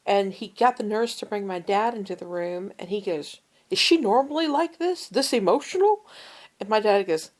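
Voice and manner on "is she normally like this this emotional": gruff doctor. Retold or imitated. Gruff doctor asks her dad.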